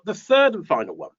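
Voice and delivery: ordinary talk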